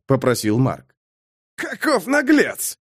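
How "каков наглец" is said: high, drawn-out amused scoff; incredulous, tickled jeer; chortled exclamation. A narrator's reading. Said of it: "хохотнул Бэкфилд".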